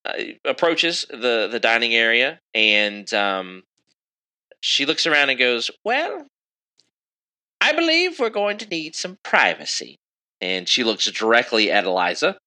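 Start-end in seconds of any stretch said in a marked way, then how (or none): 5.8-10.0 put-on high, regal old queen voice; elderly queen, composed. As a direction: pitch it well up, as a queen hinting at orders.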